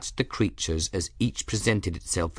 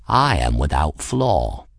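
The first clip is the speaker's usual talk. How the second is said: low, self-admiring cat voice; proud cat; 5 semitones lower than usual. A narrator's reading. Cat boasts to the girl he saved, conceited. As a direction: declare it deep and grandly conceited.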